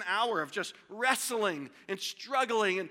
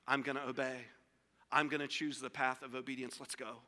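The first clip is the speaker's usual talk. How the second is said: low, soft, settled decision; quiet resolve; 8 semitones lower, 7 dB quieter than usual. Acted. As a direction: resolve it softly, dropping to a hush. Voicing Jesus' settled decision.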